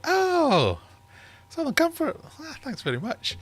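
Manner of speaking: high, gushing mock delight over the gift; fake delighted thanks. Thanking Tom for an unwanted birthday drink, mock gushing. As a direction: squeal a falsely thrilled thank-you. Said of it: "And I was like".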